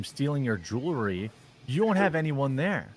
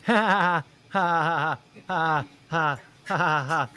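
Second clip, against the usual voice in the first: loud, drawn-out scornful ha ha ha; mocking laugh; 4 semitones higher, 6 dB louder than usual. Real, not acted. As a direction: laugh right at the clip.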